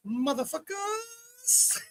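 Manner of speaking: very high, stretched-out squeal; celebratory toast. Real, not acted. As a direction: draw it out long and high. Drawn-out high toast on stream.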